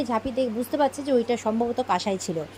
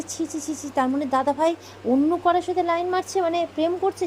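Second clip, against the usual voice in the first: scandalised disapproval; 6 semitones higher than usual. Retold or imitated. Sister's retold shock at rumoured affair.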